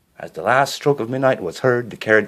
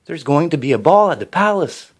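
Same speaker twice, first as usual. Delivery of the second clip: stepmother's voice, higher and louder; stepmother exclaiming; 8 semitones higher, 6 dB louder than usual. A narrator's reading. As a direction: announce the ball with a stepmother's flair. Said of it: "her stepmother exclaimed".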